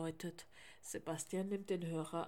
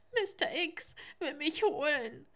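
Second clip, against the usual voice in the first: high, louder tearful fear; frightened, tearful; 8 semitones higher, 8 dB louder than usual. A narrator's reading. Sebastian telling Manuel's mother who threatened him, tearful fear. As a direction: plead it out, sniffling and scared.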